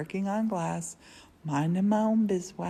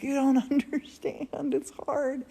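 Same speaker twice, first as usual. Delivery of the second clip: high, louder retold protest with a laugh in it; mock pleading protest; 8 semitones higher, 5 dB louder than usual. Woman protesting to Morton about mom's boxes, high mock pleading. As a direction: plead back, half laughing.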